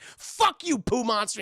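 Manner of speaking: high, loud mock yell; angry yell. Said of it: "Doctor Psycho's like".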